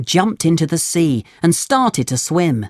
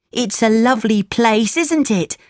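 put-on boy voice; 7 semitones higher than usual. Lampwick voiced in story.

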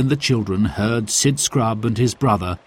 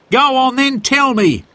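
much higher, louder, impatient demand; 5 dB louder than usual. Acted. Harry urging Sid to tell the joke, higher louder impatient demand. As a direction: hurry him up, fed up. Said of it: "said Harry impatiently".

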